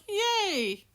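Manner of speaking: high, drawn-out squeal of delight; excited cheer. Retold or imitated. Crafter acting out how excited they were, excited cheer. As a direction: let out a long, high-pitched excited yay. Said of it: "I was, like, all excited".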